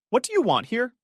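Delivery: high, fearful question; lightly voiced frightened woman. A narrator's reading. Woman to a stranger at her door, fearful. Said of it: "You could hear the fear in the woman's voice".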